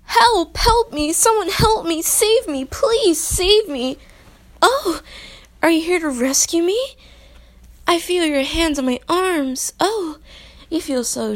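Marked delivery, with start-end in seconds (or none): 0.0-4.0 high, loud cries for help; calling for help. Pretend. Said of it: "let's try this again". 4.6-10.2 excited. Pretend. Damsel greets her rescuer.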